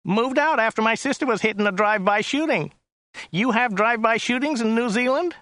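high, whiny chauffeur; Joe's whiny voice. A narrator's reading. Driver describing the client to his passenger, high and whiny. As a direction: pitch it up into a whine. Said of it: "His voice was rather high and whiny".

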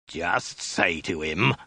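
low, slow snarled order. A narrator's reading. Soldier coaching a captive woman, snarled. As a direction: snarl it slowly, drawing out the words. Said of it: "the soldier snarled again".

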